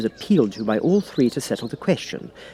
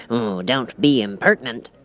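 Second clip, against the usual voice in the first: lightly voiced huffy king; a touchy old king scolding. The King scolds the Cat, lightly voiced huffy king. King's voice.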